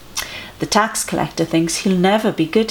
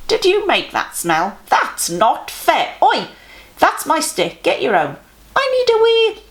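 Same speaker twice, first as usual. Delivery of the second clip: put-on higher, childish squabbling voices, squeaky on the last line; squabbling kids; 9 semitones higher than usual. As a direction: play a bunch of bickering little kids. Noisy children squabbling in crowd.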